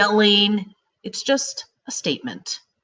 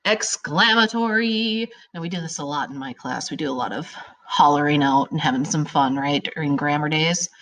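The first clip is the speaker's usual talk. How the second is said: ordinary talk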